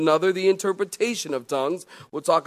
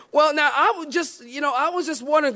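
higher, fast hesitant woman; lady caller voice; 10 semitones higher, faster than usual. Retold or imitated. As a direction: play the eager lady caller. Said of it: "So she says".

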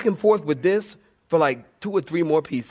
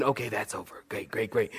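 quiet, drained relief; relieved murmur; 7 semitones lower, 11 dB quieter than usual. Retold or imitated. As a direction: mutter it under your breath, relieved. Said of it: "I'm thinking".

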